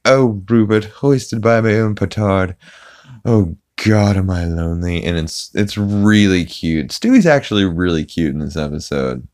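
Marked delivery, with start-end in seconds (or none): 0.0-5.0 theatrical cartoon child lament, slightly raised; Stewie. Stewie alone with his toy Rupert, mock theatrical lament. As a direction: lament it in an imitated cartoon baby voice. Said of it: "Stewie is playing with Rupert".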